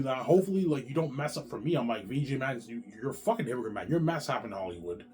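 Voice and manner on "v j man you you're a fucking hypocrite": ordinary delivery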